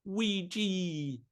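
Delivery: high cartoon Luigi call. Calling out Luigi in character.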